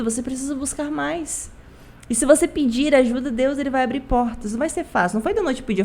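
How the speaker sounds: ordinary talk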